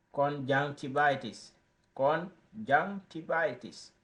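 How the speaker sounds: "Conjunctivitis" drawn out slowly, syllable by syllable; slow teaching demo. Shown as a demonstration. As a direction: spell "Conjunctivitis" out slowly for learners. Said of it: "it's very simple to pronounce conjunctivitis".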